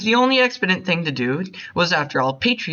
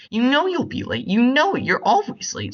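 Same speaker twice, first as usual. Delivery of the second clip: much higher, rising exasperation; exasperated scolding; 9 semitones higher than usual. Acted. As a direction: throw it at him, fed up. Exasperated wife scolds late husband.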